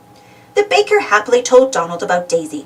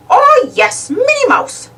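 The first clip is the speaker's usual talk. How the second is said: higher, louder friendly shopkeeper voice; cheery shopkeeper; 4 dB louder, slower than usual. A narrator's reading. Bicycle shopkeeper answering Donald's question, cheery. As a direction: chirp it like a helpful clerk.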